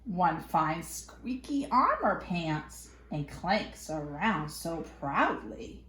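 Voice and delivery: ordinary delivery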